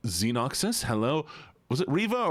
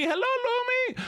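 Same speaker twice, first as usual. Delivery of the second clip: much higher, squeaky hello; high squeaky greeting. Shown as a demonstration.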